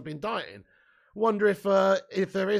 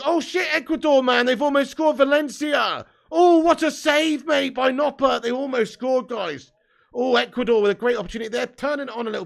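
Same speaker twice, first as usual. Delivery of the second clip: high, loud, thrilled shouts at the near goal; excited match reaction; 9 semitones higher, 9 dB louder than usual. Real, not acted. Streamer watching Ecuador nearly score, shouting excitedly to chat. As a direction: gasp and yell as the chance goes begging.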